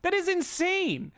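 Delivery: climbing high, slowing on That is insane; furious fan losing it over the schedule; rant peaking. Real, not acted. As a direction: boil over in disbelief. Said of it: "I'm gonna get demonetized for this rant".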